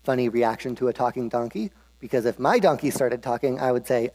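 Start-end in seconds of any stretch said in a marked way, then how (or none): none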